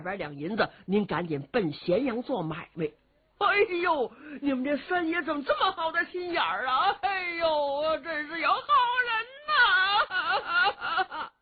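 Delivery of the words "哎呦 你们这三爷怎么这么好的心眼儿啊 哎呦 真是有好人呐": high, wailing sob put on for show; a sly man's hammed-up weeping; fake crying. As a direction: blubber theatrically to win sympathy. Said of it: "他又哭上了".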